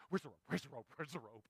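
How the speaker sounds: low, hushed, urgent dog voice; eager dog. Acted. Dog hunting for its tug rope, eager. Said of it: "She's like".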